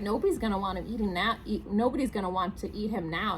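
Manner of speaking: ordinary delivery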